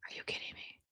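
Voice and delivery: incredulous whisper; whispered exasperation at the computer; whispered disbelief. Real, not acted. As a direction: hiss the disbelief under your breath. Mouse still won't move.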